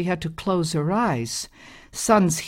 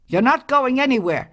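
loud, much higher desperate cry; husband cries out; 8 semitones higher, 5 dB louder, faster than usual. A narrator's reading. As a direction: yell it as you lunge for her. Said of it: "her husband cried".

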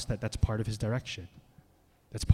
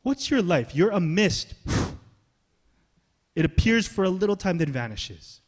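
rising preacher emphasis; 6 semitones higher, 6 dB louder than usual. Real, not acted. Preacher pressing the point.

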